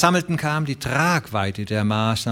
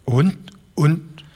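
put-on higher, halting old-man voice; stammering old man; 6 semitones higher than usual. A narrator's reading. Old Broderson stunned by his letter, stammering higher. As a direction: stumble over the words as if stunned. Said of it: "stammelte der alte Broderson".

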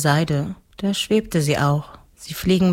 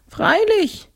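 very high, tearful-sounding ghost voice; whiny ghost count. A narrator's reading. Ghost admits his meanness to girl, high whimper. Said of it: "sagte das Grafengespenst weinerlich".

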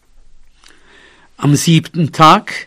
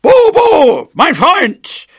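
very high, loud trumpeting call; huge, blaring, high-pitched shout; blared like a trumpet; 8 dB louder, much slower than usual. Acted. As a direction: blast the name out like a trumpet. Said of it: "wie von einer Trompete geschmettert".

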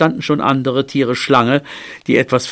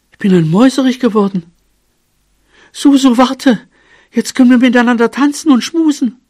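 high, loud, quick jubilation; giddy, high-pitched excitement; overjoyed cry; 10 semitones higher, 5 dB louder, faster than usual. A narrator's reading. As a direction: shout the good news to a sweetheart. Transformed mouse calls out in joy.

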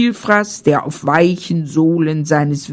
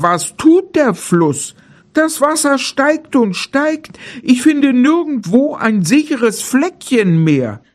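lightly voiced bear, rising in alarm; worried bear; 5 semitones higher than usual. A narrator's reading. Bear telling the deer the water keeps rising, mild worry. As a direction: sound lost and uneasy as the bear. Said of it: "rief der Bär".

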